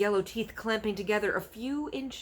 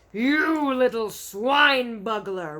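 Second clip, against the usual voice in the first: slow, much louder furious roar; 6 semitones higher, 11 dB louder, much slower than usual. Acted. Bloodbottler raging at the BFG. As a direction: roar the insult with rage. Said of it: "roared the blood bottler".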